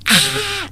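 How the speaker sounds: high, soft mock scream; grito imitado. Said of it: "escucho un grito". (grito agudo imitado en voz baja)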